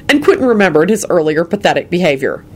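ordinary delivery

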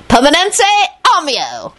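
high, ringing spell, falling at the end; triumphant yell. Acted. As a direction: cast it triumphantly, high then dropping. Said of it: "Lupin yelled triumphantly".

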